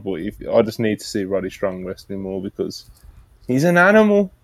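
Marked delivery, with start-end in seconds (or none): 3.5-4.3 excited. Real, not acted. Hyping Roddy's wrestling.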